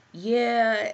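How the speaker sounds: drawn-out, dazed mock reply; imitating disoriented man. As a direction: lightly act someone disoriented. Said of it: "you could tell he was disoriented".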